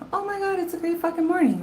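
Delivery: high, fast, gushing delight; gushing joy. Retold or imitated. Retelling her own ecstatic morning, high and fast. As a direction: quote yourself bubbling over with joy.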